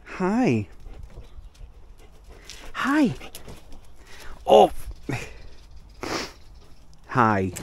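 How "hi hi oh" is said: high squeaky hello to a dog; squeaky dog greeting. Real, not acted. Greeting the dogs.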